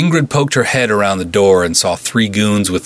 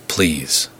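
soft, lower, gently reassuring please; calm reassurance; 4 semitones lower, 3 dB quieter than usual. A narrator's reading. Beef soothing the frightened nurse, soft and calm. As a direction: coax her gently into trusting him. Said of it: "The calm reassurance in ... voice".

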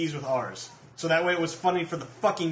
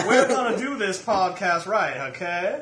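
badly faked Southern accent, slowed down through laughter; 2 semitones higher than usual. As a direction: lay down the law like a bad Southern plantation owner. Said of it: "Your accents are terrible".

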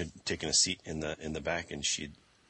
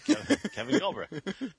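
laughing shout out; 5 dB louder, slower than usual. Real, not acted. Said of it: "shout out to".

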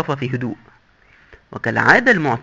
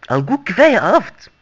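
disgusted; 5 semitones higher than usual. Acted. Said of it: "ويبصق يمينا ويسارا في قرف".

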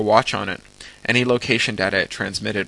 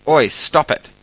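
high, loud shout of Oi, stop it; 6 semitones higher, 8 dB louder, slower than usual. Acted. Guard yelling at a sweeper bot to stop, loud shout. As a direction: bark it to halt the bot.

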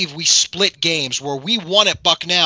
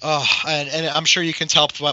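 normal talking voice

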